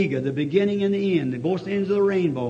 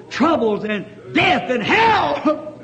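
high, loud, slow preaching shout; full-force preaching; 8 semitones higher, 5 dB louder, slower than usual. Acted. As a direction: hammer it home to the congregation.

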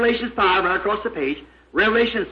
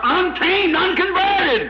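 high-pitched pulpit cry; impassioned, high preacher's proclamation; fervent preaching; 8 semitones higher, slower than usual. Real, not acted. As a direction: ring it out high over the congregation. Preacher cries out to congregation.

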